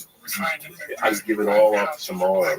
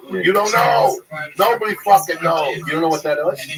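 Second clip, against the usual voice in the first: louder, higher, worked-up insistence; excited outburst; 8 semitones higher, 7 dB louder than usual. Real, not acted. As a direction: blurt it out, thrilled and insistent. Excited outburst about a beloved show.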